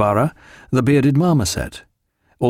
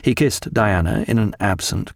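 ordinary delivery, nothing marked

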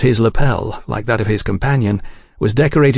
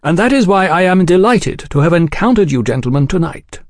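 high, lilting gentlemanly explanation; cordial gentleman journalist; 8 semitones higher, 4 dB louder than usual. A narrator's reading. Oliphant describing his book to the agents, cordial. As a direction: explain your work with smooth courtesy.

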